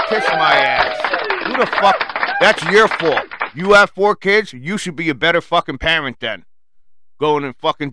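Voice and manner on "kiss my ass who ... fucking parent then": much louder, higher furious shouting; angry yell. Real, not acted. Yelling at the mother with four kids, furious. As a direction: blow up at her.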